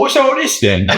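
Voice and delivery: high, hammed-up British catchphrase; silly British accent. Grappler distracting a laughing opponent, high mock British accent. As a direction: pitch up a comic British "what's going on here". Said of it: "say something silly in said accent".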